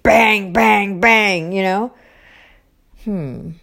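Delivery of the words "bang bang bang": three loud, punched bangs; pounding acted out in words; imitated door banging. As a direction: hammer each word like a knock. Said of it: "knock on everyone's door".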